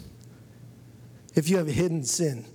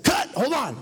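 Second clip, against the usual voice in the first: sharp high call of cut; mock producer halting the shoot; producer's call; 8 semitones higher than usual. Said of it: "the producer says".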